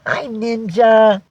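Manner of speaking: high, drawn-out singsong hello; greeting passed to the cat. Pretend. Owner passing a viewer's hello to her cat, singsong. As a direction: lift it high and stretch it for a pet. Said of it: "Vicky says".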